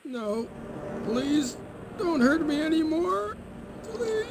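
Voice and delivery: high, halting captive's plea; frightened pleading. Acted. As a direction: beg them to stop hurting you. Drugged captive begs not to be hurt.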